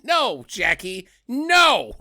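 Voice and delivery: high, slow, drawn-out scolding; mock scolding. Co-host telling off Jackie for her view, mock scolding. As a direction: scold like at a naughty dog, high and loud.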